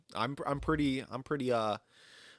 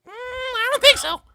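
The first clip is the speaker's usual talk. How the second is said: very high, loud little creature; cartoon character voice; 8 dB louder than usual. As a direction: answer in a squeaky cartoon voice. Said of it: "on a show with that voice".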